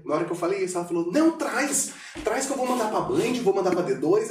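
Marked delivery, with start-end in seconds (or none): 1.1-4.3 high, eager boss voice pushing hard; Ju's excitement. Retold or imitated. Retelling how Ju eagerly urged him, excited. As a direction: gush like an enthusiastic mentor. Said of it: "aquela empolgação dela, energia dela".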